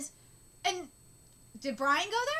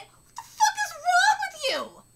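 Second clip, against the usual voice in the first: much higher and louder; angry shout; 11 semitones higher, 6 dB louder than usual. Real, not acted. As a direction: yell at him in disbelief. Angry reaction to the character.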